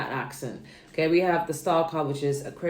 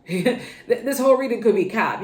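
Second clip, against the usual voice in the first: laughing; 6 semitones higher, 6 dB louder than usual. Real, not acted. Laughing at own reading.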